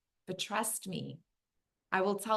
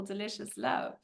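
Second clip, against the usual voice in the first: ordinary talk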